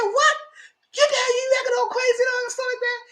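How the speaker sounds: very high squawked scolding; mock outraged groom. Groom confronting his partying bride, hammed-up outrage.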